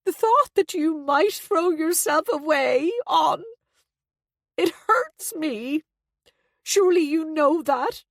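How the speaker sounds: high, slow, tearful pleading; wounded mother with tears in the voice. A narrator's reading. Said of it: "There were tears in Abby's voice".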